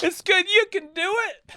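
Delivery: very high, loud cheering encouragement; imitating students cheering up a bombing comic; encouraging crowd. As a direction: chirp it like kind students cheering you on.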